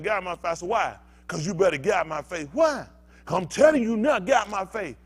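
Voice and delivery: escalating mock argument, ending loud; acted confrontation. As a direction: build from firm warning to a shouted get out my face. Acted quarrel, both sides.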